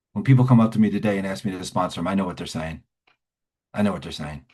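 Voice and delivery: ordinary delivery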